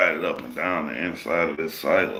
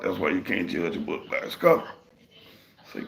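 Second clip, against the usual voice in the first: ordinary talk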